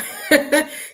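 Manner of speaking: high-pitched giggle; amused laugh. Real, not acted. Laughing at the chat name.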